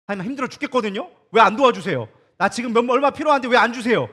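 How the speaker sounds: loud, complaining demand to God; whining for help in mock prayer; demanding whine.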